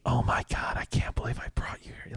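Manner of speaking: a mortified mom whispering under her breath; horrified whisper. Retold or imitated. Friend's mom in the theater, horrified whisper. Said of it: "his mom was just like".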